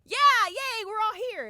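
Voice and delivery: high, loud mock cheering; excited cheer. Fan reacting to being pointed at, excited cheer. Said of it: "And I was like".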